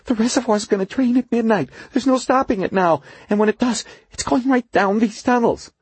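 a frightened worker explaining fast; nervous engineer voice. A narrator's reading. Duffy warns urgently.

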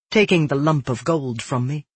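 usual speaking voice

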